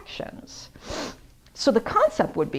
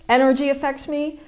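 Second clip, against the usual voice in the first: ordinary delivery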